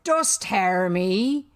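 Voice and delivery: loud, very slow call. A narrator's reading. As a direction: stretch the summons out across the water. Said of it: "Hook raised his voice".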